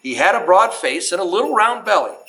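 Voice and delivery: put-on reading a Christmas poem aloud to children, lilting; storyteller voice, unhurried and sing-song; story narration. Museum host reads to the kids in a storyteller voice.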